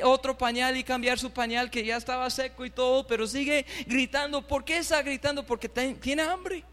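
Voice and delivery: ordinary talk, nothing marked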